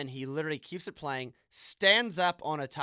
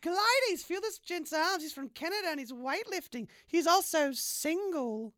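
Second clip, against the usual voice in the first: much higher put-on woman's voice; flirty woman Abatha; 13 semitones higher, 4 dB louder than usual.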